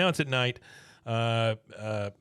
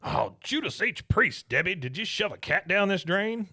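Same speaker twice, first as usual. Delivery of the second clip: mock Buck exclaiming; 8 semitones higher than usual. Buck yelling at Debbie about the clogged drain, mock outraged. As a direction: play Buck griping.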